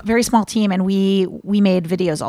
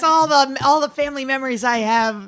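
higher-pitched, laughing sarcasm; mock nostalgia; 4 semitones higher than usual. Joking to friends about supposed boozy family holidays, mock nostalgic. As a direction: reminisce sarcastically, voice lifted with amusement.

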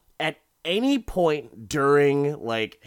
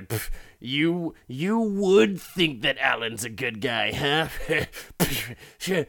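mock scoffing jab with a puff; lightly acted snide dig; passive-aggressive; 2 semitones higher, 3 dB louder, slower than usual. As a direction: scoff it out, imitating a sulky husband. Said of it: "passive-aggressive all fucking day".

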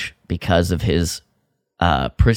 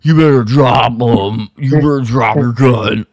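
mock goon threat; 3 semitones higher, 4 dB louder than usual. Goons threaten Kyle.

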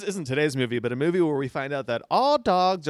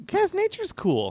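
much higher, slow and airy; high dreamy voice; slower than usual. Pretend. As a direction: float it up high like a sweet dreamer. Joking reverent voice.

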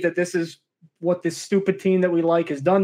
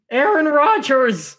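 higher, drawn-out cry of disbelief; voice cracking; 7 semitones higher, slower than usual. Real, not acted. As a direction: say the name slowly, voice breaking in exasperation. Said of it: "My voice is cracking".

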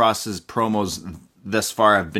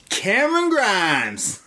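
announcer call; 9 semitones higher, slower than usual. Retold or imitated. Announcing the entrance.

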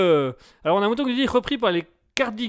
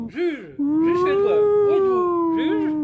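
calling out; 10 semitones higher, 4 dB louder, much slower than usual. Acted. Calling out for the judge.

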